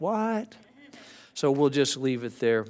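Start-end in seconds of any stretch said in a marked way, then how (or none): 0.0-0.6 sharp higher baffled question; puzzled "what". Retold or imitated. Congregation members who missed the reference, baffled high query. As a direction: play the lost listener. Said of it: "looking at me like".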